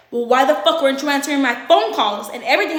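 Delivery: acting out his irate demand; angry interrogation. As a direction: fire off an angry, loud complaint. Acts out his angry questioning.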